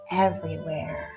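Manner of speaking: ordinary delivery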